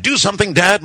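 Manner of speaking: high-pitched anxious plea; urging. A narrator's reading. Son begs dad to help the lizard, urging. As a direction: plead like a kid, pitched right up. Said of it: "my son urged".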